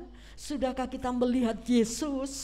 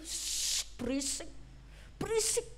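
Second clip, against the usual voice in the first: hushing; 4 semitones higher, 7 dB quieter than usual. Acted. Crowd hushing the blind beggar.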